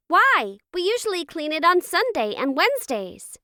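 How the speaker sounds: put-on high, whiny-kid protest; arguing child. A narrator's reading. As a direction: argue back like a put-upon child. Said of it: "Ollie argued again".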